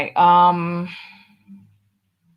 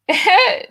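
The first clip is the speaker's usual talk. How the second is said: short high-pitched laugh; a real, brief high laugh; 12 semitones higher, 4 dB louder than usual. Real, not acted. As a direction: burst out in a quick laugh. Reacts to the next comment.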